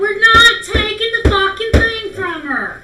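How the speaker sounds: angry shout. Real, not acted. Players arguing over stealing item.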